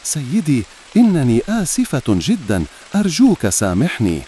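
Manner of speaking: narrated plea with a touch of distress; trembling whisper. A narrator's reading. Cracked jar begging the farmer's forgiveness, mildly trembling. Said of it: "وهمست بصوت مرتعش".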